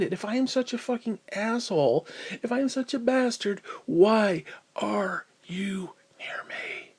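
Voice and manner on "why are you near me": whispered question. Real, not acted. Asking visitors why they come, a slow whisper. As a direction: drop to a pained whisper.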